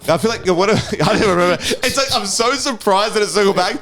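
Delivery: high laughing, words breaking up. Real, not acted. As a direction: giggle through it all. Laughing fit over losing track.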